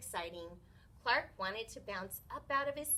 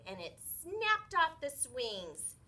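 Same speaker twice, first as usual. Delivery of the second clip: ordinary delivery, nothing marked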